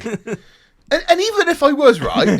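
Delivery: getting wound up while retelling it; real frustration. Real, not acted. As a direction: let the irritation run away with you. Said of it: "I'm getting fucking frustrated".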